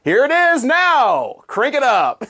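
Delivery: hammed-up radio outro; cheesy DJ voice. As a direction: stretch it out like a corny DJ. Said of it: "just doing the cheesy D J voice".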